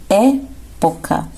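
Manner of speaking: época, very slow, weight on é; stressed first syllable. Shown as a demonstration. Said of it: "apenas uma proparoxítona". (época bem devagar, destacando o É)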